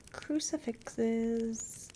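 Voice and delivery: usual speaking voice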